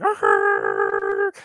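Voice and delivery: low, drawn-out braking noise; imitated screech of a stopping train. Teacher likening inner resistance to stopping a train, imitated braking noise. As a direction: grind out the noise of a train halting.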